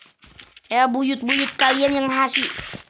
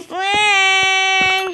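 high, drawn-out mock crying bell; mock wail; 6 semitones higher, 3 dB louder than usual. Tolling a mock funeral bell for the panda, wailing.

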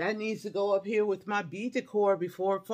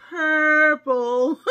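loud, drawn-out exclamation tipping into a laugh; delighted reveal; 7 semitones higher, 8 dB louder than usual. Real, not acted. Showing viewers her favorite color, delighted reveal. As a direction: stretch the word out with relish.

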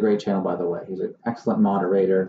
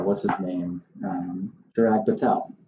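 ordinary talk, nothing marked

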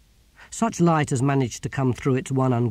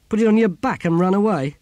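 loud, higher mocking question; scornful, raised-voice jeer; sneering; 8 semitones higher, 7 dB louder than usual. A narrator's reading. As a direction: scoff at the very idea. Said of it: "sneered Simony".

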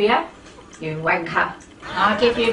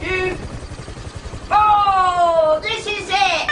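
much higher, gleeful whooping; gleeful charge; 10 semitones higher, slower than usual. Real, not acted. Gamer charging at enemies in the game, high gleeful whoops. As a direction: crow with delight as you charge in.

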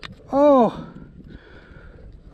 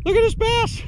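squeaky high excited cry, far higher pitch; voice going high. Real, not acted. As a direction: let your voice jump way up in delight. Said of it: "My voice, my voice getting a little high".

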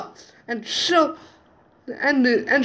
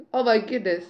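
ordinary talk, nothing marked

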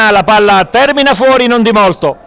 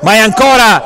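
ordinary talk, nothing marked

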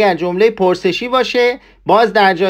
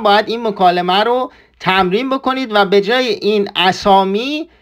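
ordinary talk, nothing marked

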